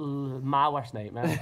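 very slow, drawn out; slow and dramatic. Real, not acted. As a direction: drag it out for drama.

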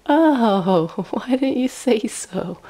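mock dawning realization with a laugh; sudden realization. Traveler finally understands the German man, acted sudden realization.

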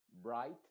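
"bright" with the "ai" diphthong and a final T, GH silent; English "bright". Shown as a demonstration. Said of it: "Entonces diríamos". ("bright" en inglés, sin pronunciar la G ni la H)

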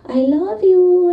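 put-on slow, high sweet toddler coo. Toddler voiced after smacking mom.

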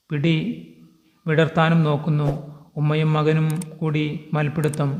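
ordinary delivery

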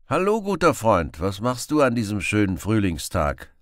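bright, loud friendly hail. Acted. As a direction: call out gladly to the stranger.

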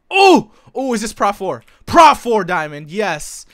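yelling over a lucky find; excited. Real, not acted. Finds rare Prot four loot.

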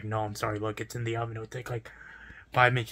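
low, soft, placating apology; not shouting. Retold or imitated. Pizza worker telling a waiting customer about the delay, not shouting. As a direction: keep it down, politely apologetic. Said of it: "obviously I didn't shout at her".

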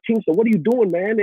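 high, startled question; alarmed dad. Retold or imitated. A father finding his son throwing stuff, alarmed. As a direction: catch the kid mid-mess. Dad checking on son's tantrum.

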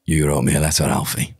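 low Birmingham gangster snarl; Tommy Shelby scream. Acted. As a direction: growl it like Tommy Shelby, a touch better. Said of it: "a bit better".

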